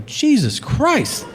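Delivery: high, slow, stunned; drawn-out high disbelief; shocked. Retold or imitated. Shocked by father's words.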